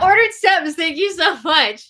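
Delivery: high, loud and giggly; laughing thanks. Real, not acted. Host thanking a corrected donor, laughing. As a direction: belt the thanks through a laugh. Amused after correcting viewer's name.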